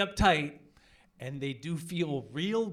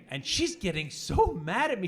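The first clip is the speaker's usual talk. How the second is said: ordinary talk, nothing marked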